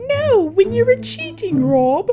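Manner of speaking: high, loud whiny accusation; imitating Sophie. Sophie throwing old cheating at Rob, high whiny voice.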